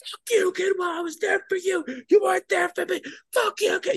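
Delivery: very high, loud wailing scream; hammed-up tearful screaming tirade; sergeant's mock sobbing meltdown at him; mock crying rant. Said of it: "losing his shit on me".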